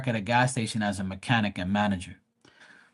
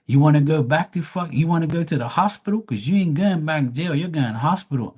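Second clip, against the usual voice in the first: higher, heated mock threat; 6 semitones higher than usual. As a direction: scoff and threaten him. Speaker threatens customer.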